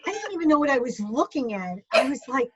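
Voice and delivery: normal talking voice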